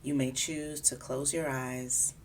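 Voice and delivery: calm, unhurried body scan guidance; guided meditation. Acted. Guiding a listener through a body scan, calm meditation voice. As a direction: ease the listener gently from part to part.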